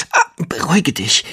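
forced out through pain; straining hard. A narrator's reading. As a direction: squeeze the words out in pain. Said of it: "presste sie hervor".

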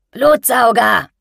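lauter, höherer Zwischenruf einer Verkäuferin; ausgerufen. A narrator's reading. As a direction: wie eine aufgebrachte Händlerin in die Menge rufen. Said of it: "rief eine Verkäuferin". (laut gerufen)

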